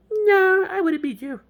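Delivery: put-on high falsetto girl; mock girl voice. Girl answers James about the race, mock falsetto. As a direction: put on a high-pitched woman's voice.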